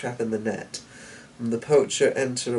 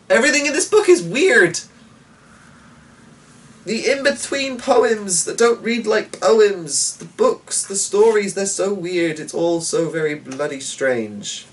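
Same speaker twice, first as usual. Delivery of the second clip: much higher and louder, bursting out then settling; excited disbelief; 9 semitones higher, 6 dB louder than usual. Real, not acted. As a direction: gush about how strange it all is. Reader reacts to the weird book.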